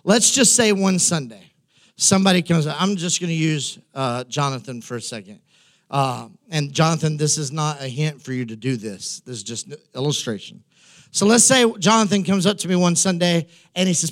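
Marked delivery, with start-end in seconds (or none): 2.8-10.6 quiet aside. Real, not acted. Aside to Jonathan.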